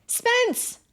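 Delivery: high, very loud scream. Acted. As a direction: yell for help from inside the house. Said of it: "Abby screamed".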